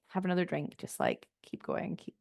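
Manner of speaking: fading to a whisper, self-coaching. Retold or imitated. Runner coaching herself through the night, fading to a whisper. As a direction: trail off into a breathy whisper, keep going.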